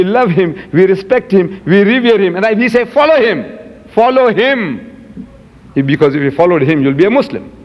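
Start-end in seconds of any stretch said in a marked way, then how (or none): 2.9-5.3 high, drawn-out exhortation; emphatic urging. Retold or imitated. Debater telling opponents what Muslims say, emphatic urging. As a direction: urge it, stretching the second FOLLOW HIM. Urging the other side to follow.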